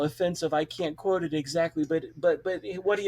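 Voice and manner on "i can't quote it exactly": plain reading voice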